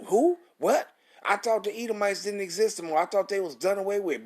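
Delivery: high, loud "Who? What?" of a baffled objector; mock incredulity. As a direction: act out a doubter caught off guard. Mock objector's shocked questions.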